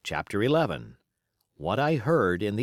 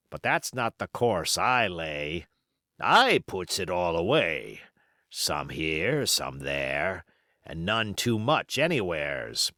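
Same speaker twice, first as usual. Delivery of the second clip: put-on old pirate. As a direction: spin yarns like a scheming old pirate. Silver's pirate voice.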